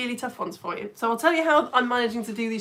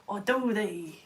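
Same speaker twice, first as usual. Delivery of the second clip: put-on pirate accent; 4 dB quieter, slower than usual. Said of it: "That was my acc".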